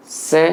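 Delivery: isolated से with s made at the teeth; dental S demo. Shown as a demonstration. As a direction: model से alone, a clean dental s. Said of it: "दंते". (से में दंत्य स)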